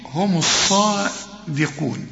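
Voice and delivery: هم الصادقون recited slowly in full; correct recitation. Shown as a demonstration. Teacher gives a student the correct reading. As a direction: finish the word هم الصادقون. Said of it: "اوعى تقول لي".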